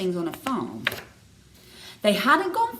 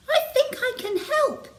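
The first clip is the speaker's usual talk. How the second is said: much higher, tiny snail voice; small snail voice. A narrator's reading. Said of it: "said a small squirrely sail".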